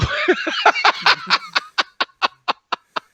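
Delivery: very high, long staccato cackle; out-of-control sadistic laugh. Acted. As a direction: cackle like a crazed villain. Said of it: "you've got this laugh that's just out of control".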